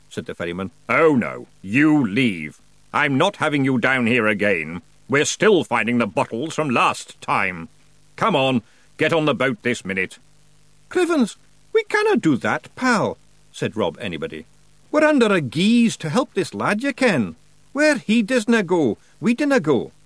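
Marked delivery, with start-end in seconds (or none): 0.9-10.2 lightly voiced gruff ferryman; a hint of an irritated boatman; grumpy ferryman. A narrator's reading. Ferryman orders the Feegles onto his boat, lightly voiced grumpy. 10.9-13.2 high Scottish pixie brogue; Scottish Feegle. A narrator's reading. Rob refuses to leave the ferryman, high Scottish brogue. 14.9-19.9 high Scottish pixie brogue; Scottish Feegle. A narrator's reading. Rob refuses to leave the ferryman, high Scottish brogue.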